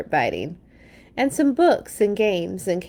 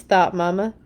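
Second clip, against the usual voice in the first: ordinary delivery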